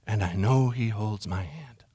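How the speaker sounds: low, slow and very soft; slow, hushed low close; hushed recital. Acted. Soft end of plaque quote.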